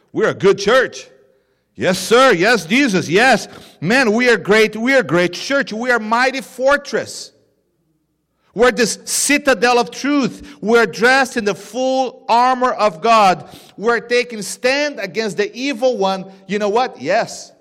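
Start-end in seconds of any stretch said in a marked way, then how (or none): 0.1-1.1 louder, proud claim of a good church; boastful churchgoer. Retold or imitated. Preacher voices a smug churchgoer. 1.8-16.3 louder, higher self-praise, mock triumph; boastful congregation. Preacher voices boasting congregation.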